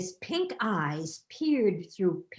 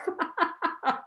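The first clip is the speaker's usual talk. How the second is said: booming laugh; 9 semitones higher than usual. Acted. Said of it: "King Claude's laugh shook the heavy walls".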